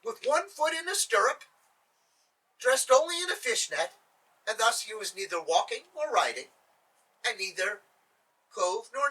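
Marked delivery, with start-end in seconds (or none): none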